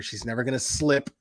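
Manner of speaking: ordinary talk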